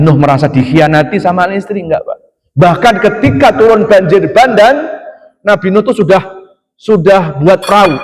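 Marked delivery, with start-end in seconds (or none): 2.6-7.9 carried away. Real, not acted. Preacher telling the congregation about Noah's flood, carried away with enthusiasm. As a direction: get swept up in the story of the flood. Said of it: "Saking semangatnya".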